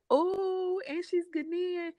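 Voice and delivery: a high, thrilled oh; pleasantly surprised. Retold or imitated. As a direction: gasp of happy surprise at the news. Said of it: "I was like".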